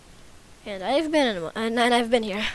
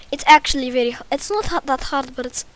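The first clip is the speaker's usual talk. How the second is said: loud, higher-pitched put-on Russian accent; 4 semitones higher, 9 dB louder than usual. Shown as a demonstration. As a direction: struggle through a mock Russian accent. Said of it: "gonna just talk in Russian accent".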